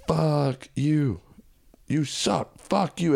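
high, held-back jeering call; imitated heckler. Heckler to comic at hometown show, mock jeering. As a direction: jeer from the crowd without full volume.

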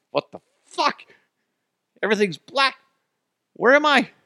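high-pitched panicked disbelief; hammed-up alarm; mock panic. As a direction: squeal it like you're suddenly lost in the dark. Imagines waking inside whale's mouth.

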